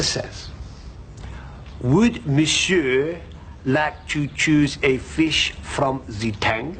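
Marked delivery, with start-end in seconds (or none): 1.8-6.8 put-on slow, drawn-out French accent; French waiter accent. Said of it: "Why does he talk like that? / Because he's French".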